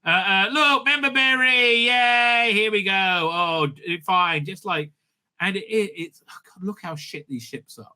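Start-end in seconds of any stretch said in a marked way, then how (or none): 0.5-2.9 high, gushing fake enthusiasm; mock cheer. Mocking nostalgic fan-service. 6.0-7.9 muttered aside. Real, not acted. Disgusted aside.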